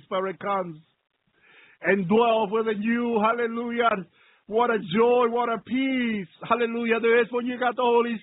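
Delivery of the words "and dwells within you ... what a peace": ordinary talk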